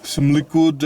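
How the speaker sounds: ordinary delivery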